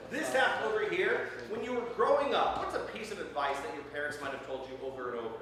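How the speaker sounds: ordinary talk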